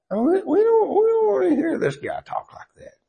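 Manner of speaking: high mocking whine of a snooty priest; sneering priest. Preacher mocking the priest of Bethel, high whining sneer. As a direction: mimic the priest's lofty disdain.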